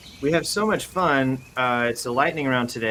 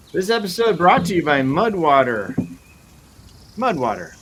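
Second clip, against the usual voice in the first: loud, higher, punchy sponsor announcement; mock ad read; 5 semitones higher, 5 dB louder than usual. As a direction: sell it like a radio ad.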